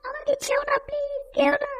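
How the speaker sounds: very high, whispered begging; tiny squeaky plea. Acted. Tied-up little creature begging not to be dropped, high whispered squeak.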